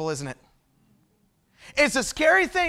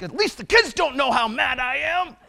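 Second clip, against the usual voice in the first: mock seething anger; 3 semitones higher than usual. Dad retells fuming at the wheel after a ticket, seething. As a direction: play barely contained anger for laughs. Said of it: "I'm so mad".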